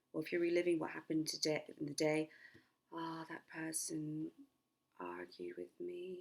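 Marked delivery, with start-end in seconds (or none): 2.9-6.2 slow, pausing between words. Shown as a demonstration. Coach models reliving the day, slow with pauses. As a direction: space the words out slowly and sleepily. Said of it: "you pause between each words".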